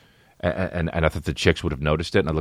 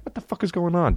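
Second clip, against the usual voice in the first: lightly acted confusion, pitched up; mock bewilderment; 11 semitones higher than usual. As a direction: voice the baffled dog in a higher voice. Voices the bewildered dog.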